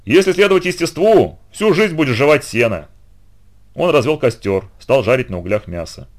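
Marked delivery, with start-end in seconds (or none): 0.0-2.9 raised, boisterous mockery; mocking. A narrator's reading. Heracles jeers at the centaur's grass-eating life, boisterous mockery. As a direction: scoff loudly at a foolish way of life. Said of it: "Геракл захохотал".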